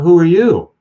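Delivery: high, loud challenging question; teenage daughter confronting the young stranger, loud and high; startled demand. Retold or imitated. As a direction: shout it at a stranger who claims to be her mother. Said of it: "And she said".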